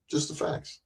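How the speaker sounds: whispered Just the facts; hushed whispered aside. Real, not acted. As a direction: breathe it out as a whisper. Repeats phrase, second time whispered.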